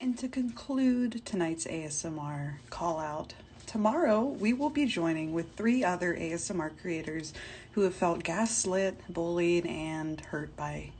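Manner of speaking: soft, low ASMR voice; ASMR sign-off. Acted. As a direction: ease down into a hushed, near-whispered close. Mock ASMR sign-off after rant.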